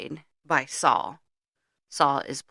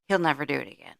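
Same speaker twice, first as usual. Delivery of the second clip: ordinary talk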